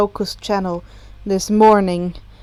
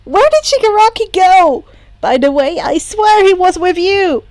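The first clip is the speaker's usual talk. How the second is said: excited question; 7 semitones higher, 7 dB louder than usual. A narrator's reading. Excited Izuku calling out.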